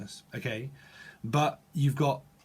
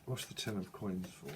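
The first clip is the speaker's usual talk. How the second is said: low, much softer question to herself; quiet aside; 7 semitones lower, 15 dB quieter than usual. Real, not acted. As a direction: murmur it to the cards.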